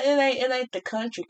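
half-conscious Southern country accent. Real, not acted. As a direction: let a Southern country twang creep in. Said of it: "trying to have a country accent here".

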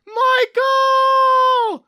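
high, loud shout of a name. Acted. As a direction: yell across to a friend. Calling to their trapped friend.

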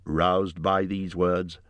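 ordinary delivery, nothing marked

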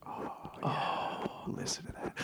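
breathy close-mic whisper; ASMR whisper. Retold or imitated. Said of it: "gently massaging the sides".

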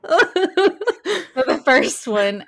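can't stop laughing starting. Real, not acted. Laughing at her reaction.

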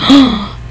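sharp high gasp of shock; retold shocked gasp. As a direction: gasp as if overhearing something awful. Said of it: "I think I made a gasp".

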